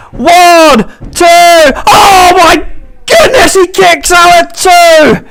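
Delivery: screaming play-by-play on a near-fall; frantic, stretched-out shouting call; wrestling commentator. Real, not acted. Near-fall in title match.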